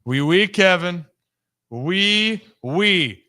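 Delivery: hammed-up French "oui, oui"; mock French accent. Playing Pierre the fitness instructor, mock French accent. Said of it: "I tried to do a French accent".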